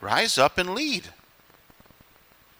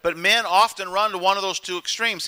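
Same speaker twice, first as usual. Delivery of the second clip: ordinary delivery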